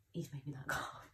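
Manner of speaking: whispered with a little laugh; quiet whisper, a laugh slipping in. Real, not acted. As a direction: hush it with a soft chuckle. Dog asleep beside her.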